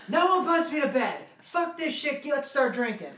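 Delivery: high, loud, defiant rallying cry; drunken bellow. Retold or imitated. Drunk back from bed to the party, defiant bellow. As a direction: shout it like a drunk reviving the party.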